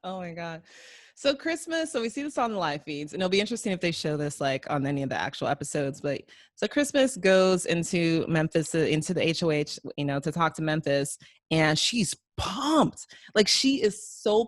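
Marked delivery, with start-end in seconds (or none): none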